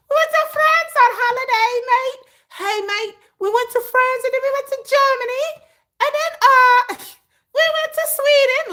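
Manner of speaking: high, loud mock English accent with mate. Imitating English friends listing trips, in a high mock English accent. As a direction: ham up a cheery English tourist. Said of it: "That's my English accent".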